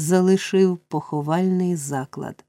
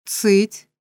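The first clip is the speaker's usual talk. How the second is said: short, stern hush; stern command; 2 semitones higher than usual. A narrator's reading. Bumble silences Noah, sternly. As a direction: cut them off sternly. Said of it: "суворо гримнув містер Бамбл".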